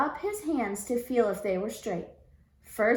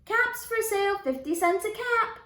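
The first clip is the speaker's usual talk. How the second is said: high street cry; calling out wares; 10 semitones higher than usual. Acted. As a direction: hawk caps to an empty street. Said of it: "he walked down the street calling".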